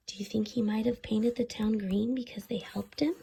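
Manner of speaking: conspiratorial whisper to the listeners. A narrator's reading. Asking the children if the leprechaun painted the town, whispered. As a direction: whisper it like sharing magic.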